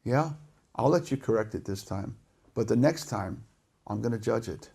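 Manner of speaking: low, hushed warning; stern, patient God. Acted. God granting one last chance before judging, low and stern. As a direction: warn softly, with quiet authority. God answering the reluctant prophet.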